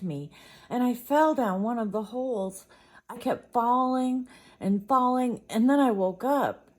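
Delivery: rising from worried to loud, high alarm; frightened retelling. Acted. Little sister telling her parents about her bad dream, building to frightened alarm.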